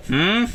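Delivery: soft, high rising hum; questioning "hmm" over the phone. A narrator's reading. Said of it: "emerge from the phone".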